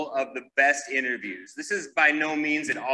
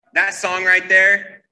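ordinary talk, nothing marked

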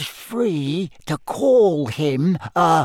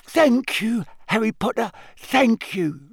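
high, breathless elf voice; puffed, high-pitched elf gratitude; 10 semitones higher than usual. A narrator's reading. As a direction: gasp out thanks as a little elf. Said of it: "said Dobby breathlessly".

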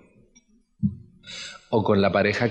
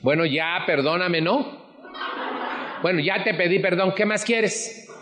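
much higher, snippy grudging apology; nasty tone of voice; 10 semitones higher than usual. Shown as a demonstration. Speaker demonstrating a nasty tone to the audience, much higher and snippy. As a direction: sneer the apology like you don't mean it. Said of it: "¿Les hago un pinche tono de voz?".

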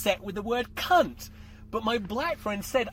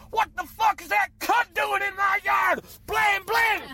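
high-pitched hammed-up furious shout; enraged dad; 13 semitones higher, 5 dB louder than usual. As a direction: yell like an outraged father with a shotgun. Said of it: "dad comes out with a shotgun and goes".

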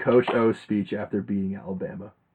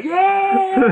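high, loud whoop; players cheering; 6 dB louder than usual. Retold or imitated. Host mimics the players roaring back, high and loud. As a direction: cheer like a locker room. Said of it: "the players like".

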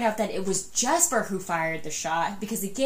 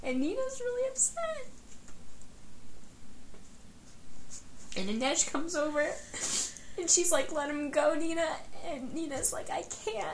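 very high, choked-up retelling; struggling to keep telling a sad story; real tearful; 10 semitones higher, 5 dB quieter than usual. Real, not acted. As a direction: squeeze the words out through tears. Tearfully retelling the death scene.

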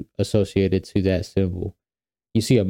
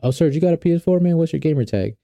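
buddy asking; 8 semitones higher, faster than usual. Retold or imitated. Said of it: "he goes".

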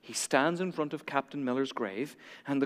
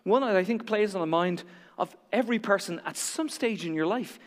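normal talking voice

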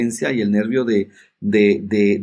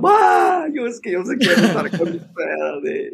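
crying plea; 7 semitones higher, slower than usual. Retold or imitated. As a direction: wail it like a boy in tears. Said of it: "Y yo lloraba". (súplica llorosa, aguda y fuerte)

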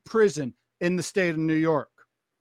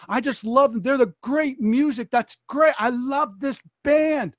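put-on higher, gushing kid raving; retold fannish enthusiasm, pitched up; excited fan; 6 semitones higher, 4 dB louder than usual. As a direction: imitate a kid bubbling over about music. Imitates kid raving about a band.

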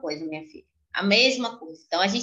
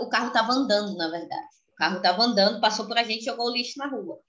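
normal talking voice

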